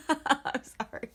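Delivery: high, laughing apology; helpless laughter. Real, not acted. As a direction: burst out laughing, then apologise slowly. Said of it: "'cause I'm laughing".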